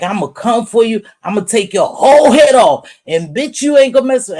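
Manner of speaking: hammed-up passionate callout; mock fierce threat. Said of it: "she always sound real passionate".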